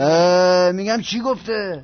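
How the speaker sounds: loud, impatient demand. Acted. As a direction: snap at him to spit it out. Boss demands what was said.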